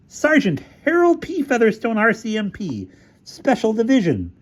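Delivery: high-pitched, forceful introduction; puffed-up officer pulling rank, pitched high; official self-announcement. Acted. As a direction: declare your rank indignantly. Officer announces his identity in argument.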